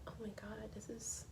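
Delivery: hushed, breathless discovery; awed whisper. Retold or imitated. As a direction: whisper it in amazement. Realizing actor's identity.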